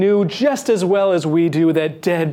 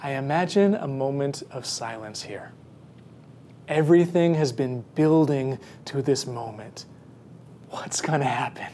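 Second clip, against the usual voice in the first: soft, low, nearly whispered at the end; hushed suspense; 5 semitones lower, 6 dB quieter than usual. Acted. Preacher imagines the waiting crowd, hushed suspense. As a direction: hold your breath and speak into the silence.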